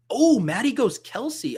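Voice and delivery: high, drawn-out surprised oh. Real, not acted. Streamer reacting to Maddie's pick, surprised. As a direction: caught off guard by the pick.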